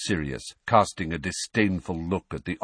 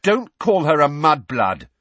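loud, high indignant shout; outraged rebuke at full force; very angry; 9 semitones higher, 9 dB louder than usual. A narrator's reading. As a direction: shout them down angrily. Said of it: "very angrily".

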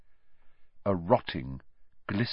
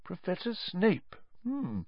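soft, higher-pitched musing; thoughtful; 11 semitones higher, 8 dB quieter than usual. A narrator's reading. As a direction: mull the name over quietly, then hum. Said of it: "looked thoughtful".

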